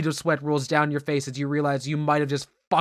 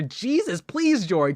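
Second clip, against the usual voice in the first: usual speaking voice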